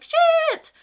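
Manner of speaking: huge, high, loud yelped curse; panicked curse. Retold or imitated. Realizing she skipped the specialist, panicked yell. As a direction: blurt it out in sudden panic. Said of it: "So I'm like".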